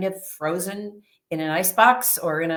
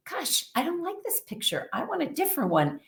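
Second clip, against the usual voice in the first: higher, softened voicing of a thought; a small, higher thinking-to-yourself voice; soft inner voice; 8 semitones higher, 6 dB quieter, faster than usual. Woman privately wishing the scene were different, soft inner voice.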